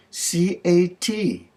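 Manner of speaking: slow, high-pitched letter-by-letter spelling; proud spelling. Acted. Missus Shapiro showing the clerk she can spell, slow high spelling. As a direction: draw out each letter, pitched well up.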